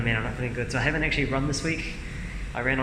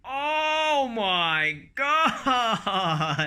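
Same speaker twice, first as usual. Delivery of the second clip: in ice cold water; 10 semitones higher, much slower than usual. Real, not acted.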